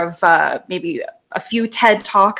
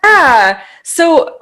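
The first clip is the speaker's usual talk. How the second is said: usual speaking voice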